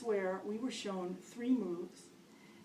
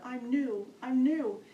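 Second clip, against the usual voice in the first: urgent disclaimer; 4 semitones higher, 8 dB louder, slower than usual. Retold or imitated. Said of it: "my disclaimer".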